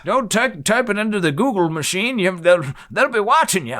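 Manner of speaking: high, loud grizzled prospector voice; old prospector. Retold or imitated. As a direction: play a cranky old gold miner. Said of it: "why I sound like an old prospector".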